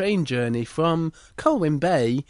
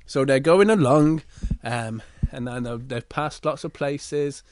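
plain reading voice

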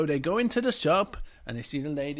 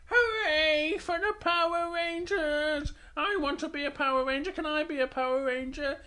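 excited Little Bear; 11 semitones higher than usual. Acted. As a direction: cheer like a thrilled little bear.

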